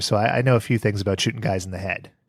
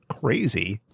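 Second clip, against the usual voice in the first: ordinary delivery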